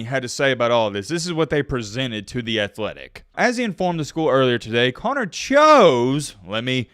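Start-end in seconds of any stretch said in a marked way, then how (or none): 5.3-6.3 "chose" stretched long and hit loud; stress on chose. Real, not acted. Reading the attorney's statement to viewers, heavy stress on "chose". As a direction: lean hard and slow on "chose". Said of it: "emphasize that word".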